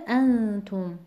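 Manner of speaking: انتم with the first syllable "an" stressed and drawn out; انتم said slowly as AN-tum. Shown as a demonstration. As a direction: lean on the opening "an" of انتم. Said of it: "وزن بر حرف ا میاد".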